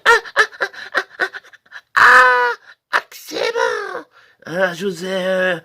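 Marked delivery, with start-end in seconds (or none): 0.1-4.0 ecstatic moans. Pretend. Salesman shows his special, shrill pleasure cries. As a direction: moan like you're in ecstasy. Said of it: "ma brasse d'homme".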